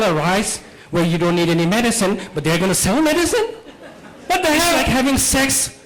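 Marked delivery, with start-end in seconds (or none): none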